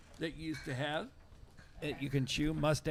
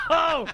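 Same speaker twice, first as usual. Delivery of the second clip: disgusted groan; 11 semitones higher, 8 dB louder than usual. Real, not acted. Disgusted after ketchup soda.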